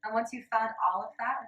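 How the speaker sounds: ordinary delivery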